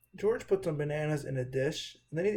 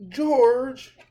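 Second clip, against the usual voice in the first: loud, high shout of a name; angry yell; 9 semitones higher, 12 dB louder than usual. Acted. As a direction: yell his name in sudden anger. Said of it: "Mister Herb yelled".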